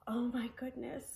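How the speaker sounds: put-on whispered oh-no to the kids; whispered worry. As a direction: breathe it like a secret. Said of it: "whispered aside to preschoolers".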